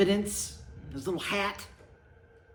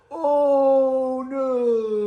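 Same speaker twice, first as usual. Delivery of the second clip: much higher, louder cry while falling; alarmed oh no; 13 semitones higher, 10 dB louder than usual. Acted. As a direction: yelp as you tumble. They tumble over him.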